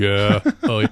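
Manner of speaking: deep, laid-back robot voice; low, cool robot. Shown as a demonstration. Podcaster demonstrating the robot voice he wants for Barry, low and cool. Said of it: "like the low, the, the cool robot".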